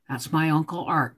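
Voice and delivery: normal talking voice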